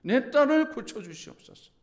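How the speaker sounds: high, loud pleading cry; desperate plea. Retold or imitated. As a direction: beg for her daughter with all she has. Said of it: "간절함이 있었어요". (딸을 위해 간절히 외치는 애원)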